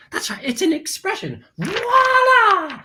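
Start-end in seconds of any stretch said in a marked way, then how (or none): 1.6-2.8 high, long-drawn flourish; showy exclamation. Pretend. Host showing off the expression, showy flourish. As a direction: swoop up high and hold it.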